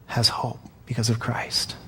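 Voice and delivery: softly, almost unvoiced; reverent whisper. Real, not acted. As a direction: hush it like a secret worth keeping. Hushed climax on Christ's hope.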